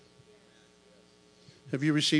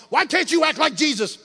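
scolding mom; 8 semitones higher, 7 dB louder than usual. Retold or imitated. Mama scolding Jesus' sibling.

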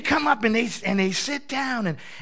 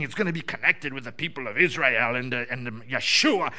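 mock pious; 10 semitones lower than usual. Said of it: "I'm thinking to myself".

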